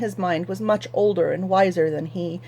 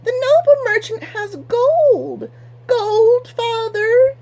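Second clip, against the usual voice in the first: much higher, slow, greedy plea; greedy protesting daughter; 7 dB louder, slower than usual. Acted. Daughter begs her father to take the gold, much higher. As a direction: drag out the gold longingly, high and whiny. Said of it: "Anhelda protested".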